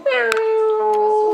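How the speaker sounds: mock kitten meow; cat meow. Shown as a demonstration. Parent voices cat for toddler.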